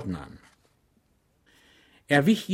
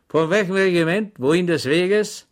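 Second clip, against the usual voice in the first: a sudden challenge from a stranger, higher and louder; unseen voice in the dark; 8 semitones higher, 9 dB louder than usual. A narrator's reading. Stranger in the haystack challenging Schweig, loud and sharp. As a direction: call out suddenly from the hay. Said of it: "vernahm er ... eine Stimme".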